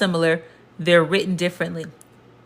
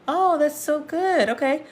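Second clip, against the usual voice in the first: high teasing delight; 9 semitones higher than usual. Real, not acted. Teasing the imagined student.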